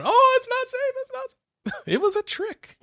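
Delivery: squeaky high cartoon voice. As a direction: pitch way up in a squeaky cat voice. High squeaky mock cat voice.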